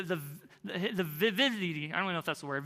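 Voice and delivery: ordinary talk, nothing marked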